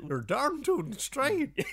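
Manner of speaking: high, slow hillbilly drawl. Pretend. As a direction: ham up an old cowboy. Hillbilly banter continues.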